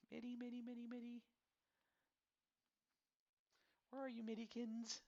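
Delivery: high, soft sing-song calling; pet-name calling. Real, not acted. Host searching for a player card, high soft sing-song coo. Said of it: "I don't know why I use pet names".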